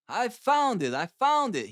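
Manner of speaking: high, jubilant cry of discovery; excited shout. A narrator's reading. Holmes shouting his discovery to Stanford, high and jubilant. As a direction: burst out like he's struck gold. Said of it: "he shouted to my companion".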